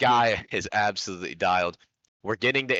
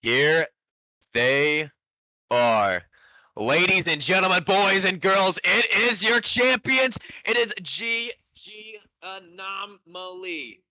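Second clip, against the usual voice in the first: loud, high, slow ring-announcer call; grand entrance announcement; 10 semitones higher, 5 dB louder, much slower than usual. Real, not acted. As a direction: draw out the big team intro.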